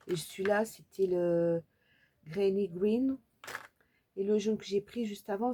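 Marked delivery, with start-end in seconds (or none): none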